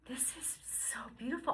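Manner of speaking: breathless hushed wonder; soft awed whisper to herself. Retold or imitated. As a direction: whisper it in awe. Awed inner thought, whispered.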